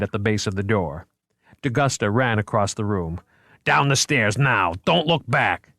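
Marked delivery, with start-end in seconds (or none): 3.6-5.7 raised driving shout; urgent command. A narrator's reading. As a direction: hurry them down. Cop herding people to flee.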